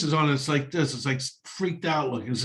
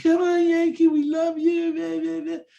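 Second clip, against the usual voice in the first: put-on high, slow little girl pleading; 3 dB louder, slower than usual. Little girl coaxing her cat, high pleading. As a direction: coo like a small girl calling her cat. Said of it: "The little girl's like".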